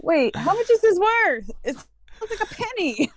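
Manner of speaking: high-pitched mock bafflement; exaggerated incredulous questioning; retold disbelief. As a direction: quote your own baffled reaction in a high voice. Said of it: "I'm like".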